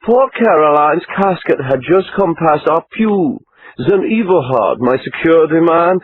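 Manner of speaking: put-on self-absorbed model in a lilting Austrian-American accent. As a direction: supermodel asking after her bodyguard, Austrian-American accent. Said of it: "in her best Austrian-American accent".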